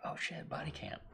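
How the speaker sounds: soft excited whisper; surprised whisper. Retold or imitated. Noticing the band shirt on the skateboarder, surprised whisper. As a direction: gasp it under your breath. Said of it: "I was like".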